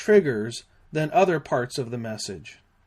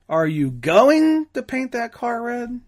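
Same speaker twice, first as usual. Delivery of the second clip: going lifted high and loud; stress on going; 8 semitones higher, 4 dB louder than usual. Shown as a demonstration. Instructor shows inflection by stressing going. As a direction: hit going, doubting they'll really do it. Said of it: "or emphasize one word".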